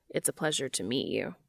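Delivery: low, quiet and formal; deep, stiff courtesy; polite deep voice. Acted. Cass polite greeting.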